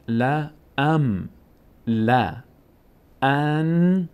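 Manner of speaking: "لا ام لا ان" very slowly, separated; syllable-by-syllable. Shown as a demonstration. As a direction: hum the closing n of "ان".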